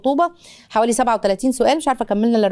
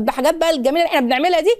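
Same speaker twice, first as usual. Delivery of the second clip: ordinary delivery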